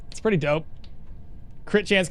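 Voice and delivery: ordinary delivery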